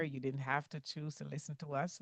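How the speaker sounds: ordinary delivery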